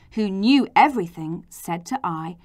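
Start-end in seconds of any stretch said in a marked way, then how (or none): none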